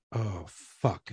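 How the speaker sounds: low, soft dawning regret; retold wife quietly realising the mistake; sheepish realisation. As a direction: let it sink in, low and soft.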